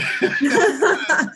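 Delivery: a high burst of laughter; real laugh. Real, not acted. Guest laughing at his own crying fits.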